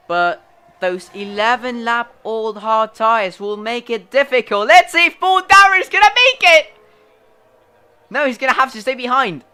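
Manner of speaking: loud, high race commentator; excited commentary. Real, not acted. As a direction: call the overtake at full pitch.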